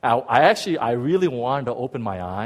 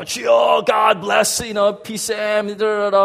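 raised, higher mock prayer voice; pastor praying fervently; 9 semitones higher, 5 dB louder, slower than usual. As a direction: imitate a pastor pumping out a blessing. Imitates pastor praying over him.

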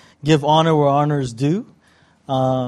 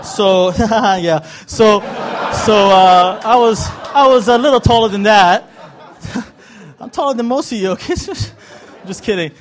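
louder, higher, laughing hard through the words; 4 semitones higher, 5 dB louder than usual. Real, not acted. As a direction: crack up at your own height joke. Joke about his height.

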